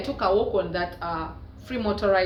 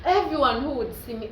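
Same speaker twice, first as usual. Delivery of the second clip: usual speaking voice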